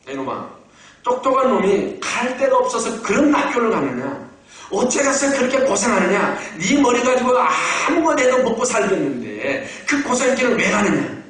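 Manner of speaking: mimicked brother-in-law's reproach; scolding. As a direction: chew him out like an exasperated in-law. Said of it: "저를 혼을 냅니다".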